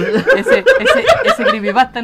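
laughing. Real, not acted. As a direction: push the words out while laughing. Talking through laughter.

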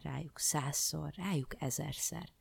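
usual speaking voice

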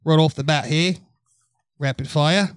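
put-on British accent, straining to hold it; British accent before it breaks. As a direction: keep the Brit voice going. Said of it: "in British accent".

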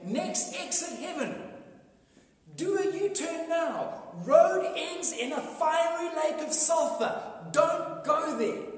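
loud, slow, urgent warning; booming slow warning to turn back; warning sign voice. Pretend. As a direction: call it out like a road sign pleading with drivers. Neon signs warning drivers.

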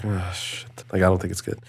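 muttered quietly to oneself; under the breath. Retold or imitated. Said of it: "under my breath".

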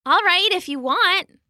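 high, puzzled agreement; confused. A narrator's reading. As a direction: agree uncertainly, voice lifted high.

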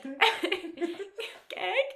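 "Greg" as a high, questioning "Geg"; R dropped. Retold or imitated. Cran calling from the tent, "Greg" without the R. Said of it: "just not pronounce the R".

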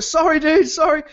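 high, loud, hurried sorry; flustered apology. Retold or imitated. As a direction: back off fast, loudly apologetic.

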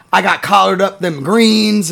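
imitating jive talk. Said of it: "and saying, like".

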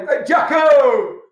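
high, loud, slow jeering call down the street; mocking shout. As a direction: yell across the street to mock him. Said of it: "we're shouting them".

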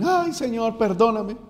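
high, mock-pleading backslider; súplica imitada. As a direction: imitate someone begging to be let back. Mimics returning backslider begging again. (súplica aguda fingida)

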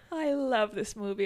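ordinary talk

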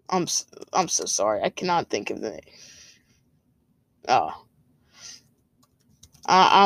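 flustered, trailing off while searching memory; blanking on name. Real, not acted. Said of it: "I'm completely blanking right here".

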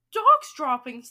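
very loud storybook outcry; shout. Acted. Witches all taking up the cry, shouting. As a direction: shout the alarm at full volume. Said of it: "they shouted".

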